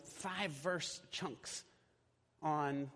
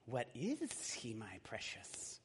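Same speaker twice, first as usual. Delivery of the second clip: slow, much softer hissing whisper; Gollum voice; 5 semitones lower, 6 dB quieter, much slower than usual. Acted. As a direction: creep the words out slowly. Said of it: "I do the voices".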